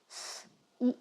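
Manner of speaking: letters breathed out softly while spelling; whispered spelling. Shown as a demonstration. Teacher spelling out a word.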